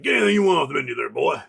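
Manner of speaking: lightly voiced generous rich man, fast and offhand; rich fisherman. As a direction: rattle it off fast like a big-shot host showing off. Rich fisherman treating him.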